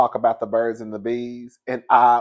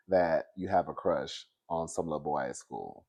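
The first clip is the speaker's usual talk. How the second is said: much lower, much softer; soft promise; 11 semitones lower, 11 dB quieter than usual. Retold or imitated. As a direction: fade it down softly. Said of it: "I was like".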